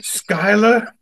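put-on puzzled voice; Frank impression. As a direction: imitate a boss getting the name wrong. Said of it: "We would do his voice all the time".

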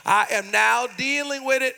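ordinary talk

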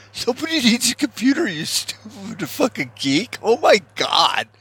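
high, fast sneering jock voice that slows at the end; hammed-up bully jeering at a nerd; mocking jock. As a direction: mimic a dumb jock taunting the geek. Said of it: "we're just running around going".

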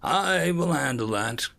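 briefing observer. Acted. Observer explaining the hearing to his assistant, emphatic.

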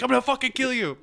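high, strained empty threat; be a pinned kid bluffing; mock kid threat. Pinned schoolyard kid threatens the winner, high mock threat.

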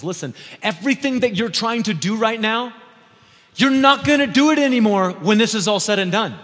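loud, high, driving sermon delivery; full-force preaching. Real, not acted. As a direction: preach it hard to the whole congregation.

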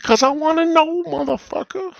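very high, loud mocking voice; dumb mama's answer. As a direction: squeak out the dumb answer as a put-on mom. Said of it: "And she's like".

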